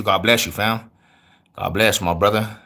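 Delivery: usual speaking voice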